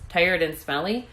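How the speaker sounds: plain reading voice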